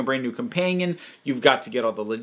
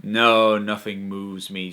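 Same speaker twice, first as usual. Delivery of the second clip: disaffected; 6 semitones lower, slower than usual. Retold or imitated. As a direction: imitate someone too cool to be moved. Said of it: "having the whole disaffected".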